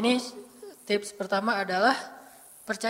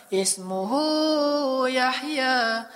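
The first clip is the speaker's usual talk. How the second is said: slow, melodic chanted recitation; Quran recitation; much slower than usual. Acted. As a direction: chant the verse slowly and tenderly. (lantunan ayat yang pelan dan merdu)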